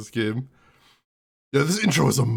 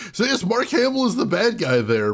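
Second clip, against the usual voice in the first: usual speaking voice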